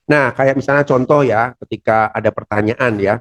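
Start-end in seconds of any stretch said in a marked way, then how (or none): none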